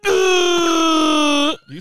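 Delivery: loud high wookiee howl; Chewbacca roar. Acted. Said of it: "go around the horn".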